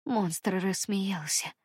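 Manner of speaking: plain reading voice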